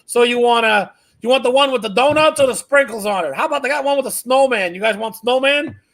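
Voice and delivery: high, eager clueless caller act; dad baiting scammers. Retold or imitated. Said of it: "I hear him goes".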